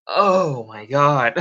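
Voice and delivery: loud, drawn-out amazement; overwhelmed exclamation. Real, not acted. Streamer pictures fifty viewers coming in, loud overwhelmed exclamation. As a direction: stretch it out loudly, overwhelmed.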